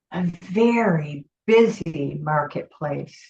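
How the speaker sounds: long calm pauses, gentle guidance; guided meditation. Acted. Leads a guided visualization.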